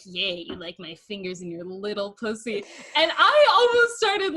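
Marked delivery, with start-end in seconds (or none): 0.0-2.6 lightly voiced sultry guy, softer; mock sexy guy. Imitating a partner's awkward dirty talk, softly sultry. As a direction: imitate his try at sexy talk, a touch hushed. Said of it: "he kept being like".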